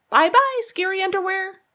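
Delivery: high singsong bye-bye; little rabbit gloating goodbye; gleeful farewell. A narrator's reading. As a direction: wave the underwear off, triumphant. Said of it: "he said".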